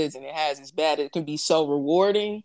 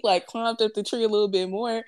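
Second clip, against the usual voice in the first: ordinary talk, nothing marked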